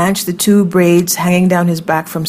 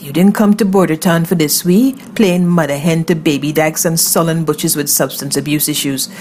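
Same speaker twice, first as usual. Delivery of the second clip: usual speaking voice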